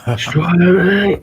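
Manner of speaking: mock Aussie accent. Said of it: "we don't say".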